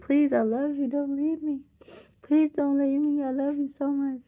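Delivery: high, pleading, tearful voice; imitated crying plea. Retelling her dad begging mom to stay, imitated tearful plea. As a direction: mimic a man sobbing and begging her not to leave. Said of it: "He was crying".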